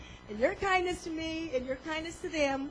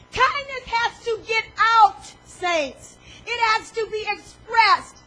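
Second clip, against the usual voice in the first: loud, slow, high preacher's proclamation with a catch of tears; full-force preaching; 5 semitones higher, 10 dB louder than usual. Acted. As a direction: proclaim it to the saints, slow and ringing.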